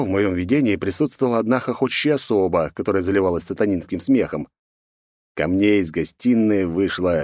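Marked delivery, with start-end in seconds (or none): none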